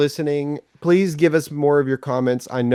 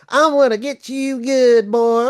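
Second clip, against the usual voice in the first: loud, high, slow Southern drawl; Southern vernacular; 13 semitones higher, 5 dB louder, slower than usual. Pretend. Co-host playfully threatens the guest, in a broad Southern vernacular. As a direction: ham up a Southern threat, much higher and louder. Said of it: "in my Southern vernacular".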